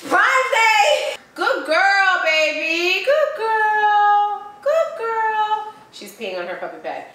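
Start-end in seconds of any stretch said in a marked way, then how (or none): 0.1-1.1 high, loud cheer of "Prime Day!"; excited shout. Real, not acted. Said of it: "But guess what, y'all?". 1.3-5.7 squeaky praising a dog. Real, not acted. Praising dog on puppy pad.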